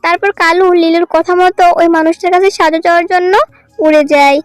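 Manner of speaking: ordinary delivery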